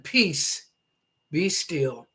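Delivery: ordinary delivery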